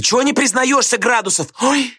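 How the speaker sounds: high, squealed challenge with a yelp; girls shouting. A narrator's reading. Schoolgirls call out the culprit, shouting. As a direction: pitch it right up like schoolgirls. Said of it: "закричали девицы".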